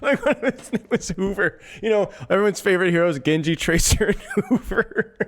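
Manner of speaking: high, giggling words broken by laughter; can barely get it out for laughing; laughing hard. Real, not acted. Streamer retelling a late night joke to chat, laughing helplessly. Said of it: "I'm laughing so hard".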